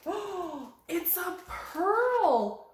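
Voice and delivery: high, slow amazed gasp of wonder; slow wide-eyed wonder; delighted surprise. Acted. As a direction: marvel at the pearl, drawn out. Discovering a pearl in shell.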